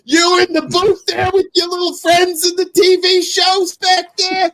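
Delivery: high, hollering mock shout-out; shouting out friends on ESPN, hammed-up holler. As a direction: yell it like a rowdy fan on TV.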